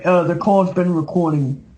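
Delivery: ordinary delivery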